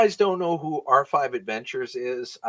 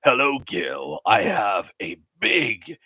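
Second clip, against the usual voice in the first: lower, slow host announcement; game show host voice; 5 semitones lower, slower than usual. Acted. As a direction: deliver it like a TV host. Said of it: "game show host voice".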